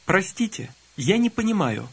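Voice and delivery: ordinary delivery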